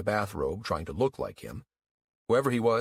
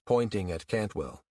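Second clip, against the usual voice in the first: usual speaking voice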